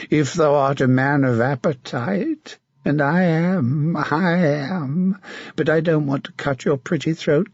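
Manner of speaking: a mad, leering knight taunting his captive; sinister, mocking menace; a twisted zealot savouring the moment; creepy villain. Sir Martin menaces Melisande.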